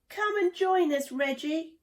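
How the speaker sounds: high, loud call across the river. A narrator's reading. Shelley calling Reggie to join them, loud and high. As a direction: call him over warmly from afar. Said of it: "called Shelley".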